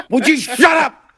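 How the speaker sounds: half-laughing, half-yelling at a bumbling co-host; laughing shout. Real, not acted.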